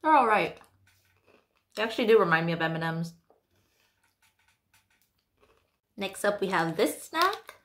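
chewing small candies; talking while chewing. Real, not acted. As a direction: mouth partly full. Seen 5: Hand puts candy in mouth; chewing while speaking throughout.

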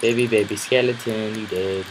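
ordinary delivery

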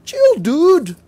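loud, high hammed-up teenager chill dude; mocking teen. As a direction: shout it like a cheeky teen at a lecturing parent. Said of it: "babanatan mo".

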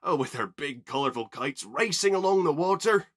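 loud, enthusiastic gushing; chirpy. A narrator's reading. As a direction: build up the thrill in full voice. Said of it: "Oliver chirped".

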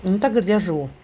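ordinary talk

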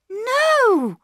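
put-on a girl rushing to stop a misunderstanding; urgent cry. A narrator's reading. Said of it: "cried Bobby".

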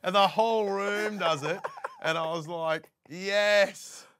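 high, loud, laughing through it; a comic retelling his big moment, laughing loud; laughing excitedly. Real, not acted. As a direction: let the laugh burst through the words. Recalling triumph, laughing loud.

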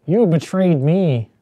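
hammed-up wounded accusation; mock betrayal. Shown as a demonstration. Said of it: "All right, that's pretty good".

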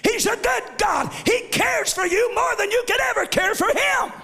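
shouted gospel preaching with rising fervor; full-force preaching. Acted. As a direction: lift the church with each line.

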